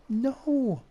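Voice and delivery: high, drawn-out wail of "no"; mock dismay. Streamer reacting to the villager's bad news, high mock dismay.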